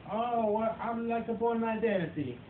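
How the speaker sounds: put-on high, halting broken English in an Italian accent; mock Italian accent. Lookalike's afternoon with Italian couple, hammed-up Italian accent. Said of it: "The whole afternoon, it was like".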